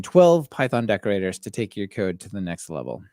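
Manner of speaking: ordinary talk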